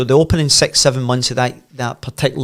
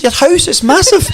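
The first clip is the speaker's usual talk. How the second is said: laughing awe; 6 dB louder than usual. Retold or imitated. Teddy amazed at the close.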